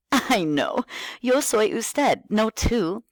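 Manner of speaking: high, bright singsong teacher voice; kindergarten teacher voice. Shown as a demonstration. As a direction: talk like you're greeting small kids. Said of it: "talk to them like you're a kindergarten teacher".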